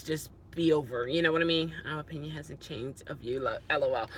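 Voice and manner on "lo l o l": usual speaking voice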